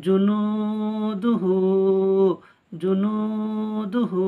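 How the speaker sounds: জুনুদুহু chanted very slowly with long vowels; drawn-out recitation. Shown as a demonstration. The teacher recites জুনুদুহু to learners, drawn out. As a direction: recite জুনুদুহু, holding the madd. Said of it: "ওয়াও মাদ্দা এক আলিফ টান".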